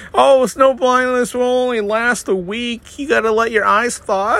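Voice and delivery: high, breezy reassuring mock voice; Midwestern nice friend. Dan imitating a friend shrugging off snow blindness, high breezy voice. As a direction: cheerfully dismiss the problem. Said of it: "they're just like".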